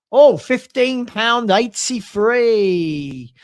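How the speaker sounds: loud, drawn-out reveal of a figure; excited reveal. Real, not acted. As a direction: announce it big, stretching it out slowly.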